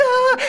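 very high acted bawl; child's crying wail. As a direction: toddler meltdown cry. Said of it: "and she's crying".

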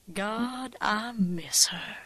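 slow, whispered longing; wistful whisper. Acted. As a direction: breathe it out missing her. Stranger remembering late mother.

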